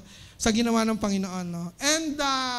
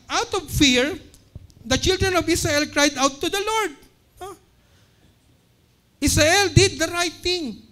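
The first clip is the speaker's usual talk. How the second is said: rising preaching; 5 semitones higher, 4 dB louder than usual. Acted. Preacher building to climax.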